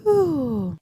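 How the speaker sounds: high, loud whew; relieved whew. Real, not acted. Recapping a hard week at home, exhausted whew. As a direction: blow out a big exhausted whew.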